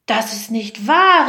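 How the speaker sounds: loud, outraged denial; storyteller voicing wronged innocence; indignant cry. A narrator's reading. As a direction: protest hotly, raising your volume. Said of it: "riefen die Brüder empört".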